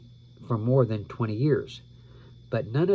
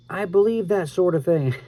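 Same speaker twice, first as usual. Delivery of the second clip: half-raised mock shout; 7 semitones higher, 5 dB louder than usual. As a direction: playfully call it out, not full yell. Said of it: "Resisting the urge to shout out".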